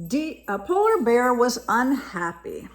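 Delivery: lively storybook reading voice; reading to children. A narrator's reading. Parent reading a picture book to a child, lively storytelling. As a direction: read it brightly to a young listener.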